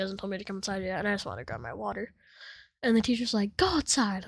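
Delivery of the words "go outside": higher, louder imitation of a teacher; stern order. Teacher sends the student out of class, stern. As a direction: act out a teacher's sharp command.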